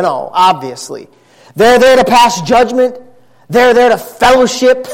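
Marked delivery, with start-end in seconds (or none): 1.6-4.8 raised, emphatic pulpit voice; forceful preaching. Real, not acted. Preacher warns his church about the weakness of the mind, preaching hard. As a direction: drive each point home to the congregation.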